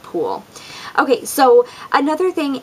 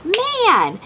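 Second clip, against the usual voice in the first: high, sharp retold "Man!"; mom's frustrated outburst; 11 semitones higher than usual. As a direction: blurt it in mock frustration while slapping the counter. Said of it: "I was like".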